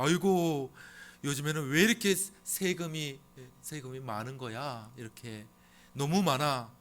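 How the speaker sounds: a complaining citizen griping; grumbling taxpayer. Retold or imitated. Citizen grumbling about taxes, mock whiny complaint. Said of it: "이렇게 하면서 불평할 수도".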